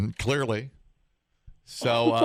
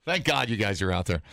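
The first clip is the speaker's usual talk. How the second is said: ordinary delivery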